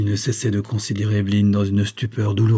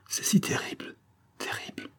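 breathless unvoiced whisper, dying away; fading whisper; 10 dB quieter than usual. A narrator's reading. Dying wife fading to a whisper as her strength goes. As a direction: let the words fade to nothing in a whisper. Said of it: "Sa parole devenait de plus en plus indistincte".

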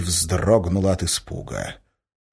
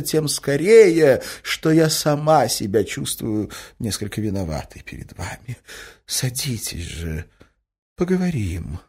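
high-voiced, sympathetic pleading, slowing into a confession; painfully tender; 7 semitones higher, 3 dB louder than usual. A narrator's reading. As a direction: soothe him with suffering compassion. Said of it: "Голос Марьи Александры был болезненно мягкий". (болезненно мягко, со страданием)

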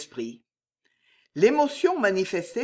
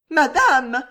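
higher, loud desperate call; desperate cry; 8 semitones higher, 9 dB louder than usual. A narrator's reading. Maid Juliette crying out to her mistress in despair. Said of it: "regard désespéré".

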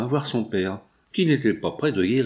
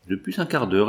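usual speaking voice

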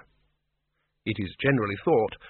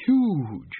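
high, swooping character voice; tempting boast; 6 semitones higher than usual. A narrator's reading. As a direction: sell the size in one word. Windle tempting the bogeyman.

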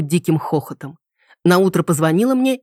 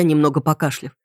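ordinary delivery

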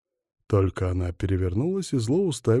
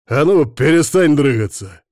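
loud, raised-pitch bark; barked order; 5 semitones higher, 8 dB louder than usual. A narrator's reading. Man to the woman wriggling on his shoulder, barking. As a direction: bark the command sharply. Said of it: "рявкнул я".

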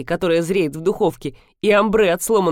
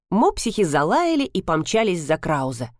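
ordinary delivery

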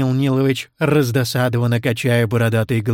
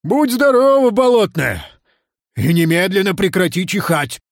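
raised-voice entreaty, higher at the start; exasperated plea, loud then settling; pleading; 6 semitones higher, 3 dB louder than usual. Acted. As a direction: beg her urgently to stop. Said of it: "взмолился Нил Нилыч".

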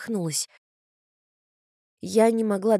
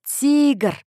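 louder, higher cry of a name; calling out; 6 semitones higher, 6 dB louder than usual. A narrator's reading. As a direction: call it out hard into the distance. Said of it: "крикнула я". (громкий оклик)

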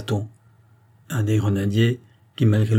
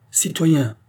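higher-pitched public call; loud address; 6 semitones higher than usual. A narrator's reading. Nobleman to the rioters, calling out high. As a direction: hail a crowd. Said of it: "dit à haute voix".

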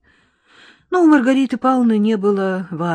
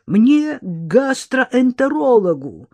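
raised pitch, slow insistent demand; acted peevish insistence, drawn out; bilious demand; 5 semitones higher, 4 dB louder, slower than usual. A narrator's reading. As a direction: demand it slowly, like a sour patient. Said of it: "требовал жёлчный худой мужчина".

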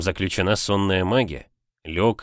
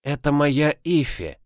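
put-on old witch voice; 6 semitones higher than usual. A narrator's reading. Witch introduces the girl.

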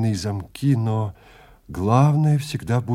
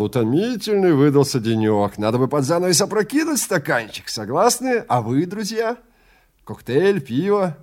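higher and louder, a bustling movie man; loud, higher-pitched hearty showman; brash Melton voice; 6 semitones higher, 4 dB louder than usual. Acted. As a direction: boom out a cheery invitation, pitched up. Melton offers drinks on stairs.

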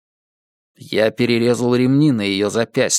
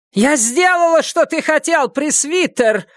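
deafening scream. Acted. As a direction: scream it up to the sky. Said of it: "снова крикнула ведьма".

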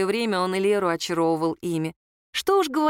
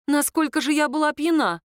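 high-pitched amazed question; astonished; 6 semitones higher than usual. A narrator's reading. Woman questions how drunk she was, astonished. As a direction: wonder aloud, pitched up in amazement. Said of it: "изумилась она".

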